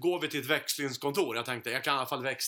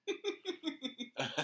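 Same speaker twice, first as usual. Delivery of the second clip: high laugh; 12 semitones higher, 8 dB quieter than usual. Real, not acted. Storyteller laughing at being cheated, high soft burst.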